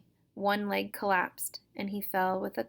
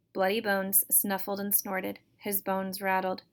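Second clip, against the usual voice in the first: ordinary delivery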